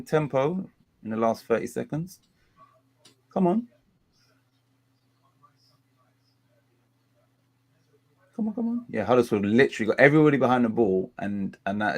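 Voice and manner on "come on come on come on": urging. Real, not acted. Urging an attack.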